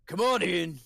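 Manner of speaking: a hearty call through a door; calling from inside. Acted. Dwarf answering the secret knock from inside, calling out. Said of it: "you hear a voice go from the inside go".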